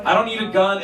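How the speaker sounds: ordinary delivery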